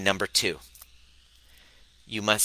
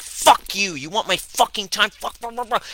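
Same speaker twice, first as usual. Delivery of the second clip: raging outburst; 10 semitones higher than usual. Retold or imitated. Burned-out coach venting at people wanting his time, angry. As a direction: snap at everyone, cursing. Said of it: "I'm just like".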